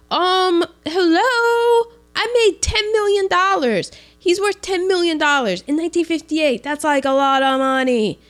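incredulous retort. Retold or imitated. Big Daddy retorting to Brick.

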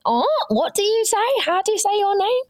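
high-pitched mimicry of puzzled people; high, put-on puzzled questioning; baffled people. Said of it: "people were like".

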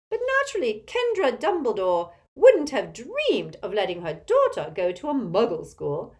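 loud, domineering Auntie Muriel character voice; imperious tipsy old aunt. A narrator's reading. Aunt greeting Harry at the table, imperious and loud. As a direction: project like a tactless old relative.

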